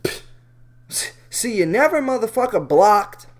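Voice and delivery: a dismissive hammed-up rant; mock outrage. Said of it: "man, I'm like".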